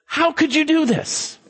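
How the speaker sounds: high alarmed question; frightened sailors. Acted. Terrified sailors confront Jonah, high alarmed question. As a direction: cry it out in disbelief.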